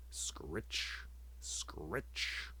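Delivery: hushed, rasping scrape of an axe on stone; whispered axe-sharpening sound; whispered scritch. Acted. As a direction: breathe it like a blade being sharpened. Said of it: "the sound of an axe being sharpened".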